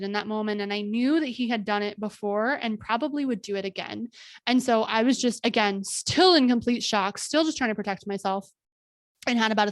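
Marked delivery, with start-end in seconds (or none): none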